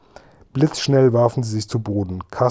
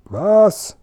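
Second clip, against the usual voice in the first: much higher, louder puzzled call; shouting back; 10 semitones higher, 4 dB louder than usual. A narrator's reading. Dimpfelmoser calls back over the engine noise, high puzzled shout. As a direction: yell it back, not hearing a thing. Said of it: "rief Herr Dimpfelmoser zurück".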